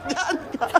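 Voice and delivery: normal talking voice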